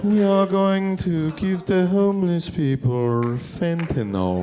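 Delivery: slow, deliberate mock Chinese accent; Olivia Chow. Comic showing the mayor's accent to the crowd, slow Chinese accent. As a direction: draw each word out in a Chinese-accented impression. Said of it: "a light accent. Have you heard her accent?".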